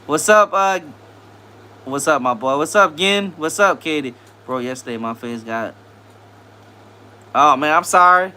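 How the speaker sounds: ordinary delivery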